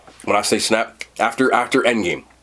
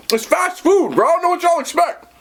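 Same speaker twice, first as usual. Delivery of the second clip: exasperated. Real, not acted. Exasperated at critics of Wendy's.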